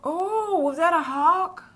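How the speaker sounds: real high, loud astonished outburst; high-pitched, loud "ooh" into an amazed question; startled excitement. Real, not acted. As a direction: cry out in surprise at what you just saw. Reacts live to a bird attack.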